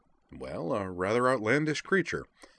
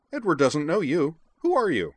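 high, loud, blunt demand; 9 semitones higher, 7 dB louder than usual. A narrator's reading. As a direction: challenge the stranger in a high voice. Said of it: "asked Edward bluntly".

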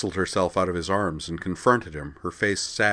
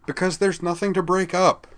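exasperated, raised pitch and louder; frustrated; 12 semitones higher, 5 dB louder than usual. A narrator's reading. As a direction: throw it up in frustration. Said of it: "throwing his hands up in frustration".

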